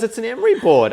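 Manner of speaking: high, loud insistent mum; imitating Mum. Imitating Mum mistaking the test, high loud insistence. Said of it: "And Mum goes".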